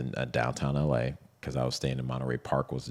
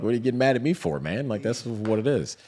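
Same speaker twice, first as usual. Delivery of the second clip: higher, louder playful mock protest; teasing exasperation; 8 semitones higher, 4 dB louder than usual. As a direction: needle him, half laughing, pitched up.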